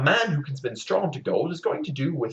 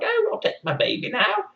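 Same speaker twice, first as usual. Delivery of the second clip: much higher sing-song goblin voice; sly imp. Acted. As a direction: claim the baby sweetly. Rumpelstiltskin claims baby.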